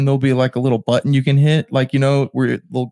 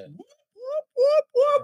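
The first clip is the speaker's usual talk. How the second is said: imitated alarm whoop; 3 dB quieter, much slower than usual.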